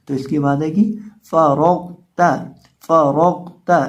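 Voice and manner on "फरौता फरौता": फरौता with thick R and ghain, light ता; loud, deliberate फरौता, full in the middle, thin at the end; heavy R, thin T. Shown as a demonstration. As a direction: darken रौ, keep ता light in फरौता. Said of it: "रॉ और गौन दोनों को मोटा".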